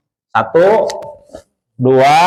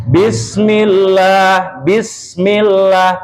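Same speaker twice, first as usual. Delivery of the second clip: very slow, stretched Bismillah; slow Quranic reading; slow recitation demo; 2 semitones higher, much slower than usual. Shown as a demonstration. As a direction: draw the word out slowly. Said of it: "tidak boleh berhenti di situ".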